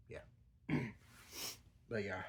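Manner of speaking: usual speaking voice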